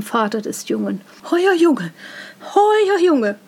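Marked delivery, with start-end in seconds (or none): 1.3-3.4 high, loud cry of complaint; loud wailing outcry. A narrator's reading. Wife rushes shouting to the boy's father, high outcry. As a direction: cry out in alarm at the boy. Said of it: "eilte dann mit lautem Geschrei".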